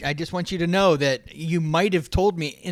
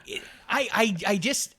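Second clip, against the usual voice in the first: normal talking voice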